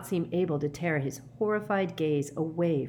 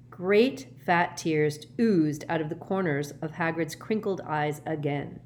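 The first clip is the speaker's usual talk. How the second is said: ordinary delivery, nothing marked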